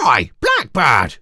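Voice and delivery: loud, slow cross shout at a bird; angry yell. Acted. Girl yelling crossly at the blackbird out the window. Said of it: "she yelled".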